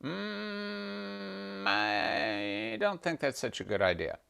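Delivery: soft, hushed Shiva voice; a quiet, calm Shiva; gentle Shiva. Acted. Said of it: "her husband said".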